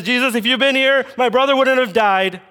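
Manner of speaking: high, insistent mock lament; acted reproach. Mary to Jesus about her brother, acted reproach. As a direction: push it high as a grieving reproach.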